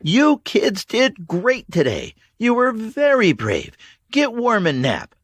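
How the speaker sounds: put-on Mags to the kids. A narrator's reading. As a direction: praise the children and settle them.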